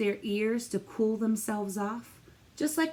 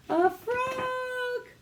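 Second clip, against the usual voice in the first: excited reveal; 13 semitones higher than usual. Real, not acted. Revealing the answer.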